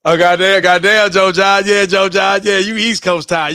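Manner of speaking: loud greeting. Real, not acted. Greeting late-night viewer loudly.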